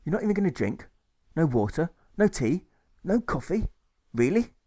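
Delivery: high, rising list of disbelief; astonished clerk, pitch leaping up; incredulous questioning. Acted. Clerk questions hunger striker.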